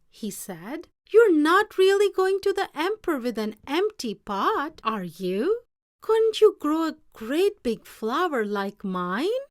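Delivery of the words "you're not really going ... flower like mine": teasing friend. Acted. Clever friend teases Ping.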